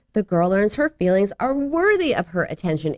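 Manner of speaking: ordinary talk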